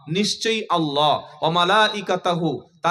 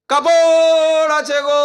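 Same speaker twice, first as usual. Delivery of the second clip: high, loud, drawn-out street call; hawker's call; 9 semitones higher, 9 dB louder, much slower than usual. Retold or imitated. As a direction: call out like a peddler selling cloth. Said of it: "এরকম টান দেয় না".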